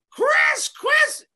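calling the name very high and loud; shrill yell. Retold or imitated. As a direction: screech for the attendant. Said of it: "He's like".

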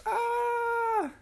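high shrill cartoon scream. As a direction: scream in fright. Rocks falling.

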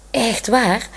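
usual speaking voice

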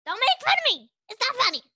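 high, loud mock-offended cry; indignant protest. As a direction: protest like being teased. Child protesting name teasing.

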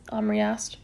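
plain reading voice

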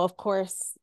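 ordinary talk, nothing marked

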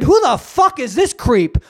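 loud, high "who the fuck"; angry dad. Retold or imitated. Father confronting the creep at the gym, loud and angry. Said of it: "and I said".

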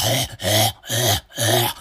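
heavy, rhythmic dog pants; dog panting. Retold or imitated. Imitating his dog in a hot room, panting. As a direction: pant like an overheated dog. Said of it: "the dog is just panting".

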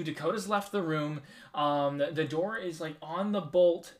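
ordinary talk, nothing marked

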